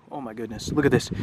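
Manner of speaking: plain reading voice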